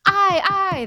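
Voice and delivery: high, loud chorus of ayes; crowd ringing out. Acted. Ghost crowd voting to haunt, loud chorus. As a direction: ring out your vote. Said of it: "the crowd rang out".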